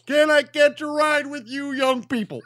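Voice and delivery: high, loud, bellowed motivational-speaker voice; Matt Foley. Acted. Hitchhiker pleading after being hit, hammed-up loud high bellow. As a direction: bellow it in an over-the-top impression. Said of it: "might as well be Matt Foley".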